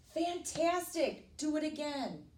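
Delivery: high, stretched-out cheer; shouted. A narrator's reading. Princess to dragon to tire him out, shouting. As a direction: egg him on with glee. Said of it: "but Elizabeth shouted".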